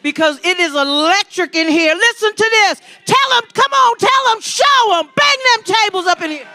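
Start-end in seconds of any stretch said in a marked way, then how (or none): 1.9-6.5 rally speech. Real, not acted. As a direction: pump up the crowd, shouting. Host calls on crowd to cheer.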